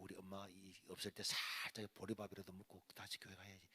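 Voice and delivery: low, hushed thinking to himself; sneaky murmur; furtive inner thought. Retold or imitated. Hungry boy planning to slip home unseen, furtive and hushed.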